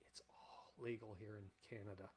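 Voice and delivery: hushed, very soft aside; conspiratorial whisper. Real, not acted. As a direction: whisper it like a secret. Sly aside inviting Tom to Canada.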